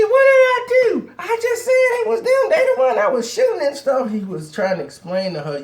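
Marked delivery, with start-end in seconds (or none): 0.0-4.1 very high, loud shrieking protest; indignant girl. Retold or imitated. Girlfriend defending herself to the boyfriend, shrieking. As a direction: shriek it like she can't see her mistake.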